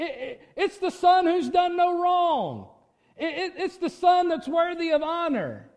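high, loud mock self-promoting cry; wrong-way cry. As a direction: call out loudly and high, mock self-important. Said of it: "you don't cry out".

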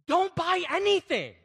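loud, high and slow, every word landed; emphatic. Real, not acted. Pastor urging the congregation, slow and loud. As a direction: drive the advice home. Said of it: "Hey, here's an idea".

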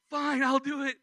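high, loud, fed-up cry of giving in; exasperated outburst. Retold or imitated. Scared boy gives in to yelling preacher, loud exasperated cry. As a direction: throw up your hands and blurt it out loud.

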